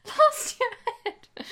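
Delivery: high whiny voice. Retold or imitated. Echoing the Gaz voice.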